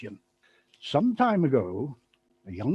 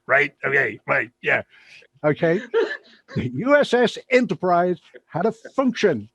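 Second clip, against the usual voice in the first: usual speaking voice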